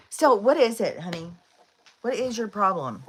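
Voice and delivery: real fed-up pet scolding; exasperated with dog. Real, not acted. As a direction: demand an answer from the whining dog. Scolding her whining dog.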